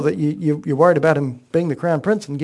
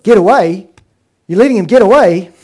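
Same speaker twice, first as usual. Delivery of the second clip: higher and much louder, outraged disbelief; furious outburst; 4 semitones higher, 10 dB louder than usual. Retold or imitated. King Saul seizing on Jonathan's word, an outraged angry outburst. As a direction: fling the words back in outrage. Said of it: "Saul now unleashes his fury".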